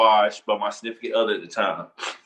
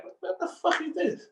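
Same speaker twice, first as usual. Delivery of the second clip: high, softer baffled question; mock disbelief; 7 dB quieter than usual. Teller acting out finding the tag on his bed, mock disbelief.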